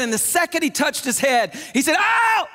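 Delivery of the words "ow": sharp high yelp of pain; mock cry of pain. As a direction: yelp like someone poked a sore spot. Patient cries out in pain.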